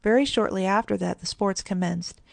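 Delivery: ordinary delivery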